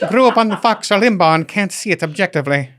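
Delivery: high, loud, laughing fake Irish lady; laughing through a failed Irish lady voice; bad Irish accent. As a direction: ham up a terrible Irish brogue, cracking up. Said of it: "I don't even know what that accent was".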